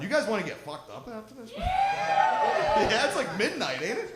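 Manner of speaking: loud, high party whoop; hyping the crowd. Real, not acted. Comic to late-night crowd, loud excited whoop. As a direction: rev the audience up with a yell.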